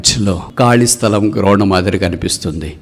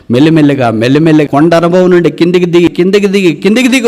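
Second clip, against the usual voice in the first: higher, louder and faster with each repeat; rising fervent preaching; 9 semitones higher, 6 dB louder, faster than usual. Real, not acted. The preacher warns the congregation about sliding down, building high and loud. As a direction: build the descent with mounting fervour.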